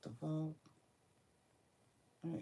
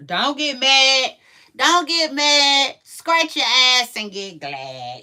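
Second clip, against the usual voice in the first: loud, slow, higher sing-song proverb; reciting an old saying; 7 semitones higher, 9 dB louder than usual. Retold or imitated. Vlogger reciting an old school saying to viewers, loud and slow. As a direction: belt out the old saying slowly and loudly. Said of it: "Like that old school saying".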